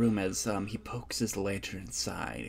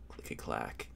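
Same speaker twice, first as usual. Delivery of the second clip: eerie low whisper of "clicky clack"; 13 semitones lower, 9 dB quieter than usual. A narrator's reading. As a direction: breathe "clicky clack" as a creepy unvoiced whisper. GM whispers the sound effect.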